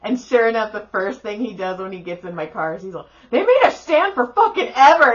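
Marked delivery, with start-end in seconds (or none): 3.3-5.1 put-on high, loud teenage griping; retold indignant outburst; pissed-off complaint. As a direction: burst out complaining about the ordeal. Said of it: "Caleb's so pissed".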